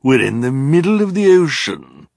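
high, loud mocking villain; sneering. A narrator's reading. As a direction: state the obvious with loud scorn. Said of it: "Olaf sneered".

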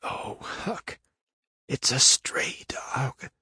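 slow, breath-only dread; barely audible whisper. A narrator's reading. Tom at the crack tells Huck of the stray dog, faint whisper. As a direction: whisper it slowly, all voice gone. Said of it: "His whisper was hardly audible".

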